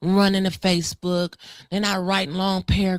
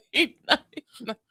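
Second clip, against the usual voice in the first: laughing; 4 semitones higher than usual. Real, not acted. Laughing at the joke.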